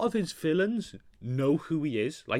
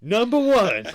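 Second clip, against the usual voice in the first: countdown announcer; 9 semitones higher, 11 dB louder than usual. Pretend.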